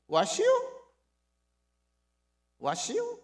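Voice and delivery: curt Chungcheong dialect greeting, rising high; blunt Chungcheong barber. Retold or imitated. Barber greeting a foreign customer in blunt Chungcheong dialect. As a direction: toss out a gruff Chungcheong-dialect question. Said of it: "충청 사람들의 말투가 좀 무뚝뚝해요".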